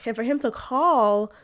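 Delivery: usual speaking voice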